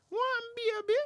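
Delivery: very high squawking parrot. Acted. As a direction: talk like a cheeky parrot in falsetto. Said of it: "and the parrot's like".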